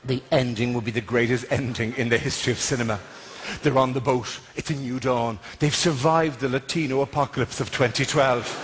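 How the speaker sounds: mock trailer voice. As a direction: narrate like a film trailer. Cinematic trailer narration.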